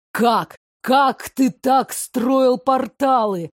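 slow, loud menacing growl. Acted. Prince interrogating the adjutant, growling slowly. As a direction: drag each word out in a furious snarl. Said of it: "рычал Андрей Николаевич".

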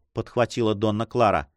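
plain reading voice

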